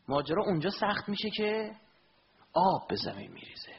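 ordinary talk

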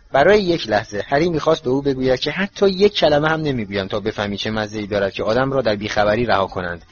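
plain reading voice

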